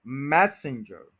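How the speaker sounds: Messenger slow, like passenger; slow model. Shown as a demonstration. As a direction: draw messenger out, stress the start. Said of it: "messenger कोई word नहीं है".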